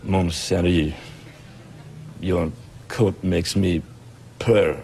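very low, slow French-tinged purr; European seducer. Acted. Bachelor asking an American fox out, sultry European accent. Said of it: "If you were European, let's hear".

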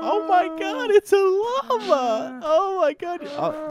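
very high, slow squeal of delight. Real, not acted. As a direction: gush over the cute llama. Delighted at finding a llama.

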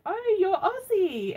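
high, stretched-out exclamation; retold excited recognition. Retelling greeting a streamer as a fellow Aussie, excited exclamation. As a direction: exclaim it slowly with a big swooping pitch. Retold delighted guess in livestream.

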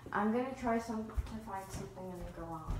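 ordinary talk

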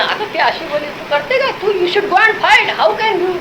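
high, stirring-up gossip voice with a drawn-out English bit; mock instigator. Husband stirring up his wife against his mother, high needling mock voice. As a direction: egg her on in a high needling tone. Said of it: "मी मुद्दाम आग बिग लावून द्यायचो".

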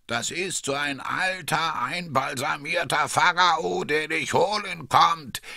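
lightly spooky lich voice; sepulchral whisper. A narrator's reading. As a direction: creep them out with a mock tomb whisper. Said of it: "flüstert mit Grabesstimme".

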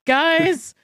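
loud, high urgent call to the group; excited quoting friends. Retold or imitated. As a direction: announce it like breaking news. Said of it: "that were both just like".

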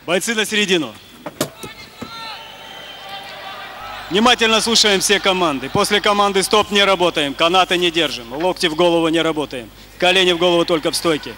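soft, brisk referee briefing; brief both fighters quickly; referee instructions. Real, not acted.

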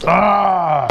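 sharp high yelp; surprised high yelp from the heat; pained yelp. Real, not acted. Hot juice splashes.

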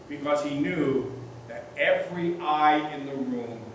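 ordinary talk, nothing marked

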